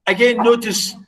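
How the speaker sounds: usual speaking voice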